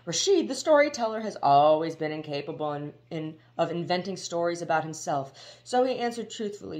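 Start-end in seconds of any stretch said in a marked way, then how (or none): none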